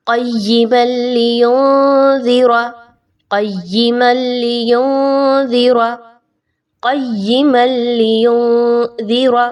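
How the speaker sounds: क़य्यिमल लियुनज़िरा slowly, qaf tied to ya, noon hidden; slow recitation demo. Shown as a demonstration. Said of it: "तो ये word कैसे बनेगा?". (धीमे, नून छुपाकर)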